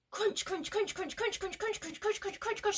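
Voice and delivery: rapid high-pitched chant; scurry through each crunch without a break; quick crunching. Shown as a demonstration. Hurrying away from Snake, quick high crunching. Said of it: "We're gonna crunch quicker this time".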